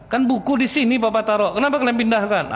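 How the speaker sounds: put-on high, sharp scolding of the kids; mock angry scolding. Father scolding children over a moved book, mock angry.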